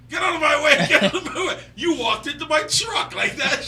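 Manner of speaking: loud, high retold yell. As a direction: yell it out, laughing through it. Retold driver yelling at pedestrian.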